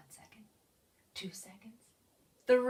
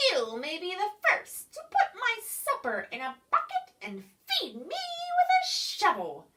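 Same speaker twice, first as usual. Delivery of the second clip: bossy creature voice; 10 semitones higher, 5 dB louder, faster than usual. Acted.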